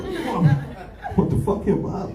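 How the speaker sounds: high startled dad yelp; startled high whoa. Retold or imitated. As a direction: jump up in shock at the cheek.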